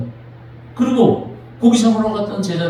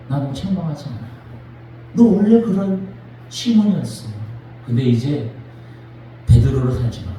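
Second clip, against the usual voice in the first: tender comfort; 8 semitones lower than usual. Acted. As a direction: speak like consoling a broken friend. Jesus comforting Peter.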